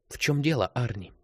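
high-pitched start sliding down, lightly voiced woman; lightly purling feminine voice; murmuring, babbling lilt. A narrator's reading. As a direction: let the question ripple gently downward. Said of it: "зажурчал её голос".

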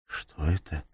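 hushed, softly voiced near-whisper. A narrator's reading. Gabriella asks her friend about a noise outside, whispered. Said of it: "прошептала Габриэла".

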